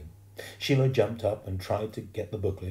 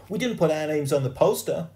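put-on high, loud kid's objection; indignant complaint; 9 semitones higher, 8 dB louder than usual. A narrator's reading. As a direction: object hotly, voice up. Boy complains to Sheila.

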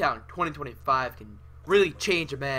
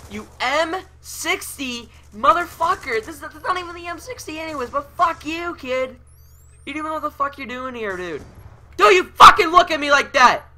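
high-pitched angry rant ending in a shout; furious rant; 12 semitones higher, 12 dB louder than usual. Real, not acted. Gamer berating the player who killed him, furious high rant. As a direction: rage at the opponent, much higher than usual. Raging at opponent in game.

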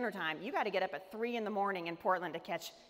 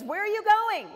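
much higher and louder, bouncy question; chatty seatmate eagerly asking where she's headed; 11 semitones higher, 9 dB louder, slower than usual. Retold or imitated. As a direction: pepper her with friendly curiosity. Cheery seatmate quizzes her.

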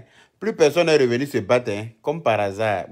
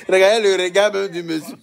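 words broken by laughter; amused, laughing hard; 4 semitones higher, 4 dB louder, faster than usual. Real, not acted. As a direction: laugh through the line. Laughing at the video.

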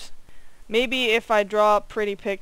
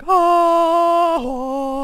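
mock gasp; 9 semitones higher, 8 dB louder than usual. Dramatic film moment.

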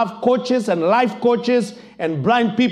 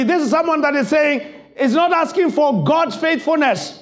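high, raised sermon voice; preaching at full force; 8 semitones higher than usual. Acted. Preacher driving home the point to the church, fervent preaching. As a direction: preach it out to the congregation.

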